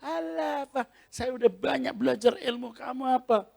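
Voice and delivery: high, sneering taunt; mock the preacher's power; mocking demon. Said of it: "dia ngeledekin saya".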